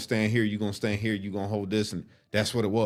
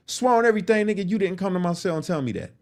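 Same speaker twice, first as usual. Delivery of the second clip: confrontational; 9 semitones higher, faster than usual. Retold or imitated. He confronts Dallas.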